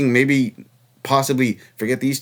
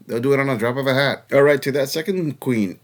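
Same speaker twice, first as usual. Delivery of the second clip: normal talking voice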